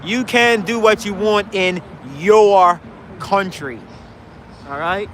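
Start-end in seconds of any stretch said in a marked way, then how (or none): none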